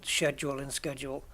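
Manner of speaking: first "schedule" as SHED-jool, second as SKED-jool; two pronunciations. Shown as a demonstration. Host admitting he swaps, "schedule" as SHED-jool then SKED-jool. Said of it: "I swap between".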